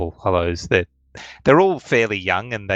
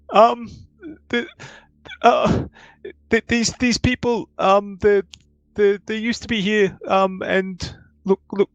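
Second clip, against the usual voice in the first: high, hesitant nervous voice; high flustered NPC; nervous Gordon; 12 semitones higher than usual. Acted. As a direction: stammer it out high and anxious.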